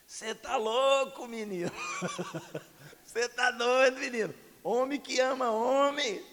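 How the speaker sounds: high, loud, laughing mock rebuke; hammed-up father scolding through laughter; gruff father. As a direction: imitate the dad scoffing at his son. Father rejecting the hug.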